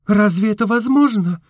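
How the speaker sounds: high, startled question; hopeful surprise. A narrator's reading. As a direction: lift up with sudden hope. Настенька lifts head, hopeful. (с внезапной надеждой, высоко)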